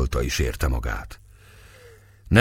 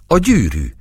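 shocked realization; 6 semitones higher, 4 dB louder than usual. A narrator's reading. Finds the ring in vest.

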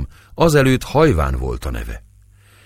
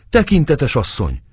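alarmed call; 9 semitones higher than usual. A narrator's reading. Maid finds mistress fainted.

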